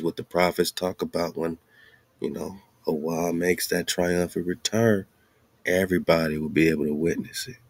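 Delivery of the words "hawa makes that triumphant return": ordinary delivery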